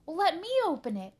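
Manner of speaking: fast, high, loud eager shout. A narrator's reading. Buster wanting to open the chest, shouting. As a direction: shout to grab a turn. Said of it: "shouted Buster".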